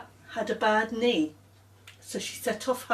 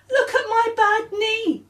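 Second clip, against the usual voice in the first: put-on very high child voice, louder; Claire's voice; 13 semitones higher, 5 dB louder than usual. Girl showing Samantha her knee, very high. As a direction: squeal it proudly.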